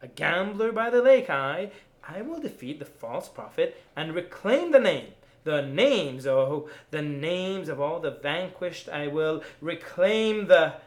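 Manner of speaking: defiant declaration. Acted. Poet vowing to the crowd to reclaim the names, loud and defiant. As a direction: proclaim victory over the prophet.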